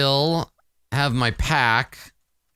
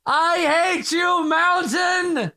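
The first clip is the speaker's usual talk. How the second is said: high, drawn-out furious shout; mad yell; much slower than usual. Acted. Character mad at the mountain, acted angry yell. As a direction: yell it slowly up at the sky. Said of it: "she gets mad and she just yells".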